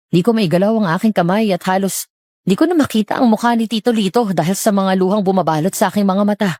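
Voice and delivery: usual speaking voice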